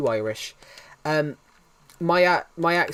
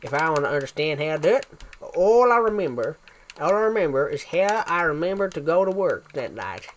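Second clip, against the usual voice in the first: Texan accent. Shown as a demonstration. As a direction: demonstrate a put-on Texan accent. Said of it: "hold my lip up a bit".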